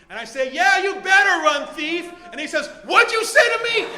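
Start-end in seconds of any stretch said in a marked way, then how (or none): 0.5-2.1 loud, high taunting shout; cocky. Acted. Owner calling after the retreating thief, cocky yell. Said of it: "And I get a little cocky". 2.8-3.8 very loud, high acted shout; enraged challenge. Thief turning back on his taunter, enraged shout.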